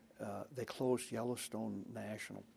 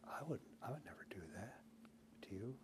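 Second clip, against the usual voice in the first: whisper; 12 dB quieter, faster than usual. Retold or imitated. Dole's stunned denial, retold.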